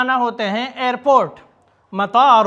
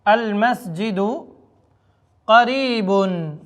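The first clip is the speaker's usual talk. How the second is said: slow model phrase; slower than usual. Shown as a demonstration. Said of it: "تو آپ کہہ لیں المسجد قریبن".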